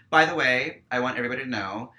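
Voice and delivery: suddenly loud, high and fast; loud outburst. Real, not acted. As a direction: blurt the warning out hard to everyone. Angry warning about the venue.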